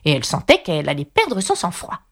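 ordinary delivery, nothing marked

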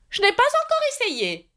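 high-pitched, solemn knight; very grave. A narrator's reading. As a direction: admit it gravely in a high character voice. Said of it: "répondit-il très gravement". (chevalier très grave, voix aiguë)

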